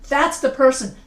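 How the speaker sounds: loud pointed accusation; loud mock courtroom finger-pointing; acted accusation. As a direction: call out the culprit, punching "that's". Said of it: "and you're pointing".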